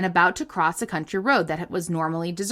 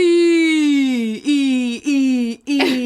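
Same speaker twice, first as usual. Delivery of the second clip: mock echo; 4 semitones higher, 5 dB louder, much slower than usual. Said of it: "It says echoing".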